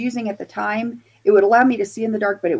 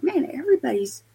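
higher-pitched mild mock complaint; bummed out; 7 semitones higher than usual. Investigator missing out on ghost experiences, mildly mock bummed out. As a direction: retell feeling left out, pitch raised. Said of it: "getting kind of bummed out".

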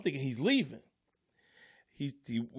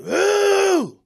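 loud high-pitched whoop; heckler noise; 13 dB louder than usual. Retold or imitated. Heckler interrupting the host, loud wordless whoop. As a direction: imitate a heckler's drawn-out yowl. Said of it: "Just making noises".

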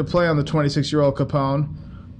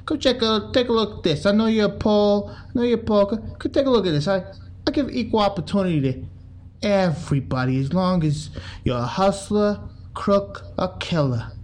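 higher, lightly voiced boss showing off the poster; imitating Capone; 4 semitones higher than usual. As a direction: wave the new hire over like a smug boss. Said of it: "He's like".

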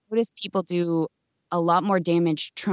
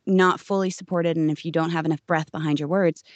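ordinary talk

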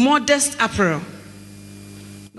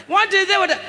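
higher, prying questions at the husband; higher mock nagging wife; 9 semitones higher, faster than usual. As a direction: pester him for answers.

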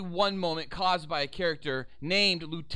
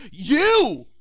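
a loud, accusing "You!" flung at him; sudden shout; 6 semitones higher, 11 dB louder than usual. Retold or imitated. Cop shouting at the agent, a sudden loud accusing yell. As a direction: bark it out like a cop losing it. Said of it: "Harrigan just goes".

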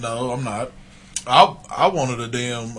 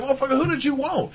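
high, incredulous mock retort to his friend; 10 semitones higher, 4 dB quieter than usual. Arguing with a Cowboys fan friend, incredulous. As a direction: throw the question back at him in disbelief. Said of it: "I'm like".

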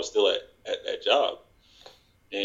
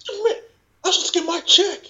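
very high, squeaky mock protest; acted dismay. Fired worker asking about his check, high mock dismay. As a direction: plead in a high, hammed-up voice of disbelief.

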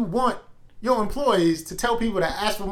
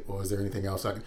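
much lower, much softer mock customer rep; mock scripted rep; 12 semitones lower, 13 dB quieter, faster than usual. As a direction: murmur it like a bored script reader. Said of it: "and then say".

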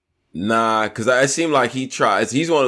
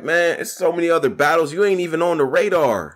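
put-on a higher, light West Coast accent on the quote; imitating the rapper's West Coast accent, pitched up; mock West Coast accent; 7 semitones higher than usual. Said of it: "the way he said it with the accent".

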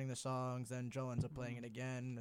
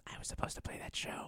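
voiceless whisper; whispered interjection; 5 dB quieter than usual. Real, not acted. Bandmate cutting into the drummer story, whispered. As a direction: whisper it as an aside.

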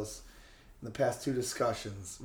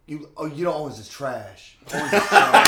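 put-on deep kid voice; 9 dB louder than usual. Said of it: "That was actually a very deep voice for a little child".

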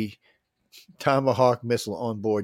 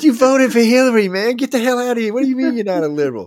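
high, loud, laughing rant; mock yelling at host; 12 semitones higher, 8 dB louder than usual. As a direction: tear into him, half laughing.

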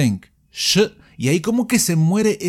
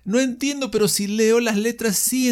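higher, lightly voiced student asking for help; imitating a student; 6 semitones higher than usual. The teacher imitates a student who cannot follow films, lightly voiced puzzled student. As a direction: play a puzzled student whining a little to the teacher. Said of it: "¿Cuántos alumnos vienen?".

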